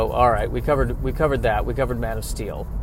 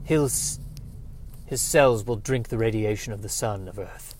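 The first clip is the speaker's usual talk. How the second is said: lower, softer, solemn movie delivery; quoting the movie line; 3 semitones lower, 5 dB quieter than usual. Retold or imitated. Quoting Man of Steel's line in a hushed solemn voice. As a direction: intone it like a grave film father. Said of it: "that was a fun line".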